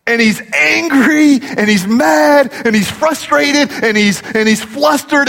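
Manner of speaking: high, slow and emphatic, each word leaned on; slow, lifted preacher's build; rising intensity. Real, not acted. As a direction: build the anger line by line. Preacher builds the owner's anger.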